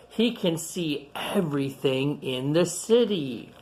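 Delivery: clear, lively picture-book reading voice; reading to children. Acted. Reading a picture book aloud to young kids, storytelling. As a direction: read it out to the kids gathered round.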